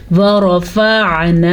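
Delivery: slow, drawn-out tajweed recitation; Quranic recitation. Shown as a demonstration. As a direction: recite slowly and melodically. Recites verse. (धीमी तिलावत)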